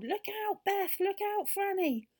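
high, loud cry of alarm; yelled warning. A narrator's reading. Joe warns Beth and Franny of falling water, urgent yell. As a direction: shout the warning to each friend in turn. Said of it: "he yelled".

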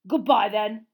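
loud, grudging farewell; very resentful. A narrator's reading. Uncle Vernon eyeing the wand, very resentfully. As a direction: force the goodbye out resentfully. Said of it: "he said very resentfully".

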